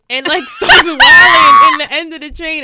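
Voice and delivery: shouting through laughter; loud laughing retelling. Real, not acted. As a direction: crack up while telling it. Laughing while retelling wailing scene.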